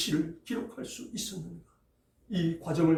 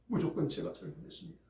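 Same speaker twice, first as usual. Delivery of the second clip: much softer and faster, 무조건 with a small rise; quiet confession; 9 dB quieter, faster than usual. Acted. David quietly repeating his confession to God. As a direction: repeat it under your breath, quickly. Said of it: "라고 고백하는 내용이".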